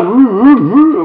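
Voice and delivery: loud high moo moo; loud playful mooing; imitated mooing. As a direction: moo like the cows. Cows mooing.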